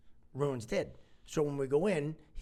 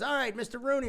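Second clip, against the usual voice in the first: higher, brisk doctor voice; doctor calling out; 10 semitones higher, 4 dB louder than usual. Retold or imitated. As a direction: call the patient's name across the room. Doctor calling the patient's name.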